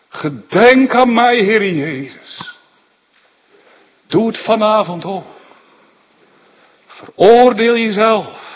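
loud, high, pleading sermon climax; fervent preaching. Acted. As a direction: call out to the congregation with full force. Preaching at full force. (vurig en luid preken)

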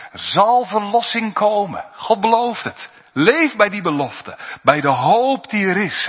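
rising pulpit fervour; fervent preaching. Acted. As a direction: proclaim the promise with full force.

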